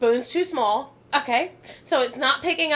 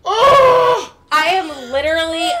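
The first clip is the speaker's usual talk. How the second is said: much higher, louder shout of exasperation; laughing outburst; 4 semitones higher, 9 dB louder than usual. Real, not acted. Ranting to a friend about the slurping noise, laughing shout. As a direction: blurt it out as a real outburst.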